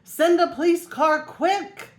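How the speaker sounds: high, slow, loud yell; Michael yelling to Clancy for a police car. A narrator's reading. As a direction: bellow the order down the phone. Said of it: "yelled Michael".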